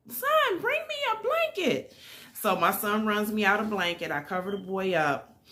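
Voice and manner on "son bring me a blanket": reenacted high-pitched call; pitched-up yell across a distance, not full volume; yelling to son. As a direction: call out urgently to someone indoors. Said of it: "I'm yelling to my son".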